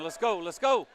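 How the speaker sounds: high, loud shouted urging; loud cheering. Real, not acted. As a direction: yell go at the players. Fan urging players.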